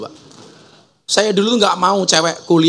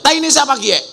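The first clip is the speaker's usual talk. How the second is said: mock yell; 10 semitones higher, faster than usual. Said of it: "Orang ngapak teriak".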